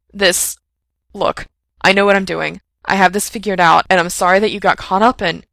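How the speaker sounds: loud, hurried apology; agitated. A narrator's reading. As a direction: rush the words out nervously. Frank rushes a nervous apology.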